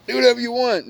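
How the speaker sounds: high, breezy mock of a spineless boyfriend giving in; weak pushover man. Mocking weak-ass men to his girl, high and breezy. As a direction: play the man who lets her do anything, lightly mocking.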